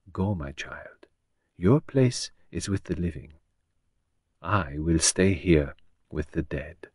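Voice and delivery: soft, slow and quiet; gently. Acted. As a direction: ease her away kindly, slowly. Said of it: "he said gently".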